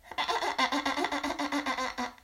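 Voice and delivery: high, rustling laugh like crumpling cellophane; little man's crackling mocking laugh; crinkly laugh. A narrator's reading. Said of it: "lachte er sein Knitterlachen".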